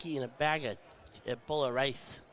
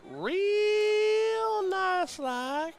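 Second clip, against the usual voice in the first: mock drawl; 4 dB louder, much slower than usual. Teasing reply to polite request.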